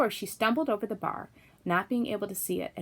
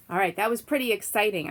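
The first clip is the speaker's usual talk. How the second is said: plain reading voice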